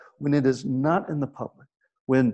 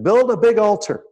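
raised, louder proclamation; bold challenge; 6 semitones higher, 5 dB louder than usual. Retold or imitated. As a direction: call it out high and strong. Elijah issues his challenge.

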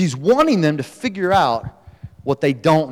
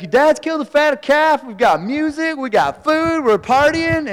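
high, eager rush of news; excited gossip; 11 semitones higher than usual. Retold or imitated. Servant tells older son about the party, excitedly.

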